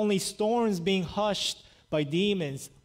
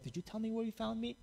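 hushed quick aside; 5 semitones higher, 11 dB quieter, faster than usual. Retold or imitated. Imagined Nathanael asking Philip, hushed.

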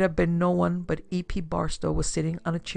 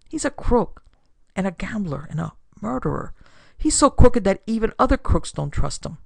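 bitter outburst, starting high and falling away; upset daughter; 3 semitones higher, 7 dB louder than usual. A narrator's reading. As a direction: denounce him, pitched up and upset. Daughter denounces her father in upset.

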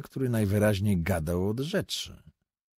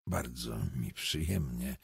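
mumbled; 7 semitones lower, 6 dB quieter than usual. A narrator's reading. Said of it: "niewyraźnie mruczał".

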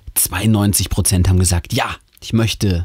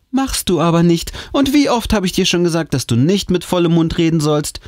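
hoher, tadelnder Mutterton; schimpfende Mutter; 9 semitones higher than usual. Acted. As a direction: das Kind mit erhobener Tonlage zurechtweisen. Mutter schimpft mit dem Kind. (hoch schimpfende Mutter)

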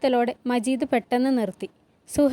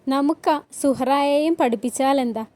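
ordinary delivery

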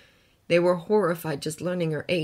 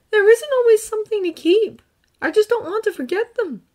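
put-on earnest boy, gently recounting; young hero voice; 10 dB louder than usual. Izuku answers.

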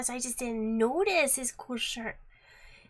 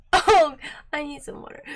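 normal talking voice

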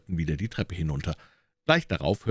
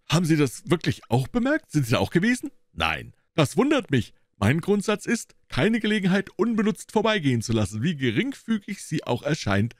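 surprised pompous detective; 11 semitones higher than usual. A narrator's reading. Gregson astonished that Holmes noticed too. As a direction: sound taken aback, then lecture.

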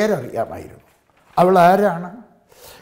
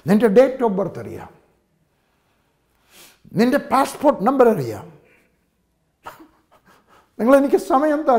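higher, louder preacher's build; rising pulpit fervour; preaching at full force; 7 semitones higher, 4 dB louder than usual. Acted. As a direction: build it up to the congregation. Sermon rising to a climax.

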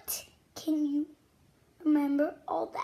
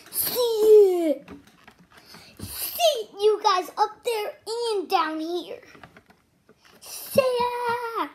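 loud, high, laughing delight; excited; 4 semitones higher, 7 dB louder than usual. Acted. Loud laughing delight, showing kids the colors. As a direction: shout it with joy.

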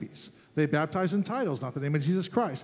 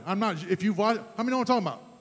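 ordinary talk, nothing marked

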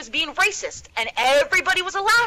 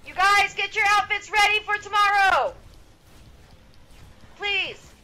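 calling out; 8 semitones higher than usual. Real, not acted. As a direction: call out across the house. Calling out to kids.

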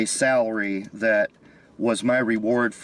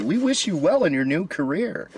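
high, fake-cheerful HR voice; chirpy corporate politeness; corporate email voice; 8 semitones higher than usual. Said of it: "their junk emails they send me say".

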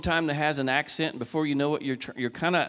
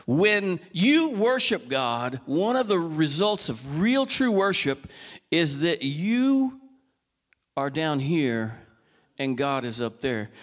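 slow emphasis; 2 semitones lower, slower than usual. Acted.

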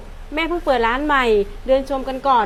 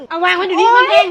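angry order; 4 semitones higher, 8 dB louder than usual. Acted. Mother ordering child.

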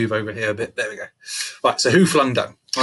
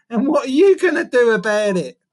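high, loud little-brother challenge; cheeky taunt; 12 semitones higher, 7 dB louder than usual. A narrator's reading. Little brother daring the monkey, cheeky taunt. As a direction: taunt him in a raised voice.

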